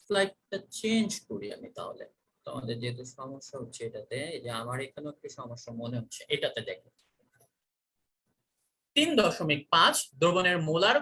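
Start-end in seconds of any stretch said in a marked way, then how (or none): 0.0-6.9 quiet aside. Real, not acted. Fixing slide problem.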